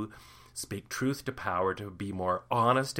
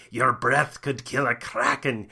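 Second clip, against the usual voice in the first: pirate voice; 6 dB louder than usual. Acted. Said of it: "learn some good pirate insults".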